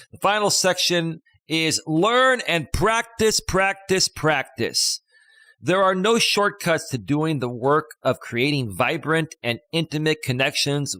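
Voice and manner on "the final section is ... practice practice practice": slow, weighted learn and practice, practice, practice; emphatic repeat. Shown as a demonstration. Host repeats the key phrase slowly for listeners. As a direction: spell out each practice deliberately. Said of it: "Let's do it one more time".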